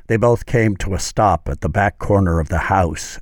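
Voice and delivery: usual speaking voice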